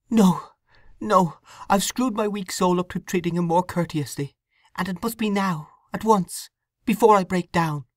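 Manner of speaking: put-on a troubled young wife confessing; young woman Sue. Sue resolves.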